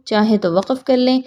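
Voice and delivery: ordinary talk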